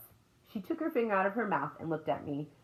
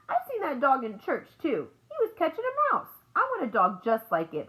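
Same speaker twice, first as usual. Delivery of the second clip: put-on high, loud, eager child's voice; pitched-up kid telling big news; excited little girl; 8 semitones higher, 5 dB louder than usual. A narrator's reading. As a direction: blurt it out like an excited small child. Voicing little girl in story.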